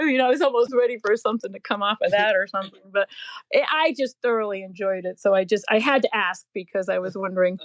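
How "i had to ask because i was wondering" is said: ordinary delivery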